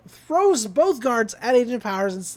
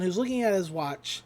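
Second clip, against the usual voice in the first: ordinary talk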